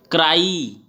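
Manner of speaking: क्राई with a long "ee" at the end; stretched ending. Shown as a demonstration. Teacher sounding out the y, क्राई drawn out. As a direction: let the final ई of क्राई stretch out. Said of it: "sound पढ़ के देखते हैं".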